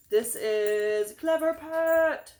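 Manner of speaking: slow, loud announcer voice; grand announcer reveal. Pretend. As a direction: present it like a showman.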